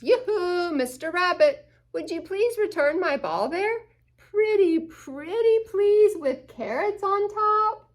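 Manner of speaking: sweet pleading otter. Acted. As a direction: coax him, sugary and polite. Otter politely asks for ball.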